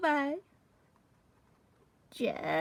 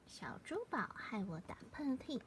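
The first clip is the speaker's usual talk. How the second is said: whisper; 6 semitones lower, 14 dB quieter, much faster than usual. Shown as a demonstration. Whispered title translation.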